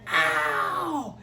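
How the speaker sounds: low, hammed-up ow; mock cry of pain. [name]'s hip pops out, a mock pained ow. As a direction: cry out as if hurt.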